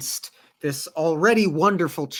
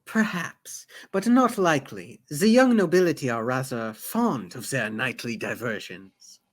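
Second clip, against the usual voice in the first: put-on accented, measured explanation; courteous mentor with a foreign accent; formal steward with accent; 3 semitones lower than usual. Sazed explaining the young nobility to his mistress, formal and accented.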